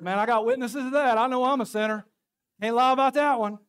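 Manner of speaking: high, loud, hammed-up church member owning up; loud mock testimony. Pastor voicing a church member admitting sin, loud and playful. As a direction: belt it out like an eager congregant. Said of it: "Somebody said".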